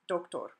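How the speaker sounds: "Doctor" as doke-TOR; Spanish "Doctor", stressed on the end; Spanish pronunciation. Shown as a demonstration. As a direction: say "Doctor" with two pure O sounds. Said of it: "it's pronounced doctor".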